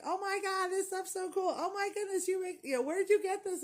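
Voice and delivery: high, gushing retold excitement; freaking out. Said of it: "freaking out".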